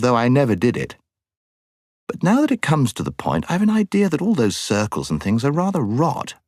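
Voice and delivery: high-pitched, earnest schoolboy working it out; schoolboy Eustace, earnest. Acted. Voices Eustace thinking it out.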